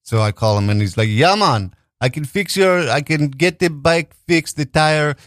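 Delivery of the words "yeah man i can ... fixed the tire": badly faked Jamaican repairman; amateur Jamaican impersonation; shitty Jamaican accent. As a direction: quote the mechanic in a poor Jamaican accent. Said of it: "I do a shitty Jamaican accent".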